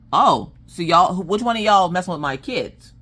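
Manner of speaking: a protective mother squaring up; full mama mode. Retold or imitated. Mother confronts boys at the playground in full mama mode. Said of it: "full mama mode".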